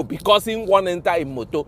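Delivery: ordinary talk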